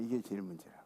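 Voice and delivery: preacher repeating it softly to herself, quiet; quiet echo. Shown as a demonstration. Hushed repeat.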